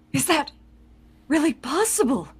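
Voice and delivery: usual speaking voice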